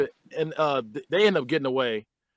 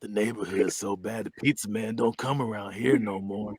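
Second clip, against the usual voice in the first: low, soft imitated character voice; put-on low voice for a quote; Pee Wee; 7 semitones lower, 6 dB quieter than usual. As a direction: drop deep and quiet, imitating the kid's deadpan. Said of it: "the Pee Wee's like".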